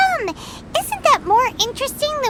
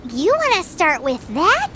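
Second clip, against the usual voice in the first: normal talking voice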